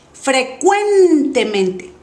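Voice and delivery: 'frecuentemente' very slow, long held CUEN; exaggerated stress. Shown as a demonstration. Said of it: "voy a exagerar primero". ('frecuentemente' muy lento, alargando CUEN)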